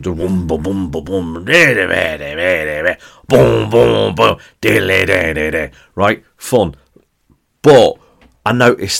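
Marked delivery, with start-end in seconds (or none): 0.0-5.7 mouth-drum "da boom, ba boom", getting louder on the "boom, boom"; beatbox the away fans' drum; imitating drum beats. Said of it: "beating the drum".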